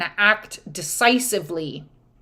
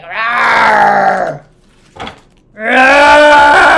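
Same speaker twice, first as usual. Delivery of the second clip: loud mock dragon roar; playful loud dragon rawr; 12 dB louder than usual. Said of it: "They're like".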